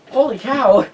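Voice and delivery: high, loud holy cow; amazed exclamation. Retold or imitated. Reporter reacting to being recognized, amazed. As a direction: blurt out your amazement.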